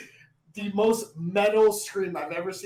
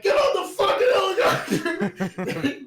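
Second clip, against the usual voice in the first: high, loud hammed-up yelling; imitated furious movie shout; mock furious yell; 13 semitones higher, 7 dB louder than usual. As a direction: yell the order, close to laughing. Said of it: "He's yelling furiously".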